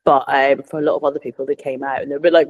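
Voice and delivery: ordinary talk, nothing marked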